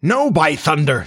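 high-pitched flat refusal; defiant cry. A narrator's reading. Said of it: "Starkey cried".

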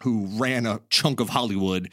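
ordinary talk

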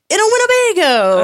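high, loud hammed-up reveal; mock exclamation. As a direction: belt it out as a comic punchline. Mock reveal of dad's wish.

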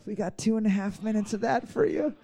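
creepy cockpit announcer; creepy pilot. Acted. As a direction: put on a sleazy pilot's patter. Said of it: "You sound like a creepy pilot".

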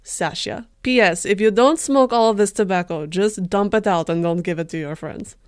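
loud, mock-dramatic Russian accent sign-off. Sasha signing off his diary entry, Russian accent. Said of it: "Should I do the accent too?".

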